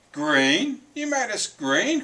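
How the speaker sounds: high, loud, rising disbelief; outraged cry. A narrator's reading. Third pig discovers he is green, crying out. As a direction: cry out incredulously, pitch shooting up. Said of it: "cried the third little pig".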